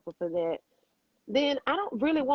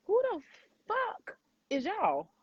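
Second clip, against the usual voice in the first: indignant outburst; 14 semitones higher than usual. Real, not acted. Indignant outburst, high pitch.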